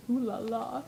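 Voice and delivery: put-on low, drawn-out leering old-guy voice; really deep voice. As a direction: imitate a creepy older man, low. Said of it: "in a really deep voice he goes".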